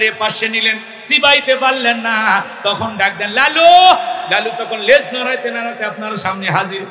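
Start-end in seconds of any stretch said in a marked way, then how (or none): 3.3-3.7 loud, high call across the yard; calling the dog. Acted. Owner calls his dog.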